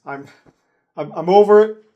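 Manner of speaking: loud, fed-up declaration; exasperated outburst. Real, not acted. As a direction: throw up your hands vocally. Giving up in frustration.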